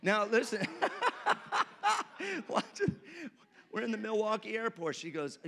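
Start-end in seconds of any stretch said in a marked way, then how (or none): 0.7-2.4 high burst of laughter. Real, not acted. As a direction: crack up mid-story. Laughs while starting a funny story.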